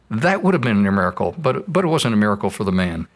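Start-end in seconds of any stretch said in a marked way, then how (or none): none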